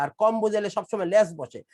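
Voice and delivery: normal talking voice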